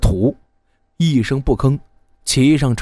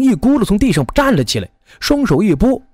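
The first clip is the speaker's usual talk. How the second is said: usual speaking voice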